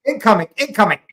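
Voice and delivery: imitating the base alarm. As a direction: chant it like an automated siren warning. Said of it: "the alarm".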